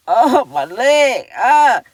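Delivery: slow, loud groan over a sore leg; worn out from shopping, moan it; groaning in pain. Real, not acted. Tired mom groaning to viewers about her aching leg. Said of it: "Barely can walk".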